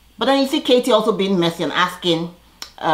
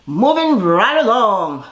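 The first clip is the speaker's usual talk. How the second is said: slow sing-song segue; 5 semitones higher, 5 dB louder, much slower than usual. Shown as a demonstration. Vlogger moving on to the next scene, slow and loud. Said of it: "same drawn-out segue catchphrase".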